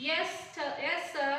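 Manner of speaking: lightly stammered reply, repeating the words; mild stammer. A narrator's reading. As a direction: stumble a little and start over, like a nervous boy. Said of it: "stammered the boy".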